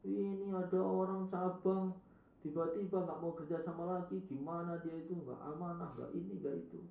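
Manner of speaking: high-pitched acted grumbling of outraged people; indignant complaint. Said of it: "orang-orang tuh menguarah semua".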